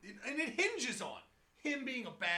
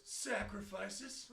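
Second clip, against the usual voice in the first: very slow, drawn-out "Sacrifices?"; slow deadpan question; much slower than usual. Retold or imitated. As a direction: stretch the word out flatly. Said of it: "the actor delivering the line goes".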